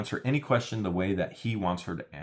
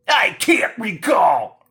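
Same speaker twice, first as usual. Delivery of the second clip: slow, high, loud mocking whine; mocking shout; 12 semitones higher, 12 dB louder, much slower than usual. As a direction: drag out the dodge in a shout. Mocking her evasive answer.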